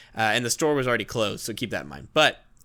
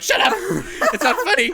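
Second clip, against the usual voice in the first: loud, high-pitched mock outburst; exasperated shout; 6 dB louder than usual. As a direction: yell back at teasing coworkers, half amused. Retold snapping at teasing coworkers.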